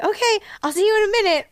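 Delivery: imitating the frantic lone worker; hurried drive-thru worker. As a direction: rattle it off like a rushed cashier. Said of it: "and he's like".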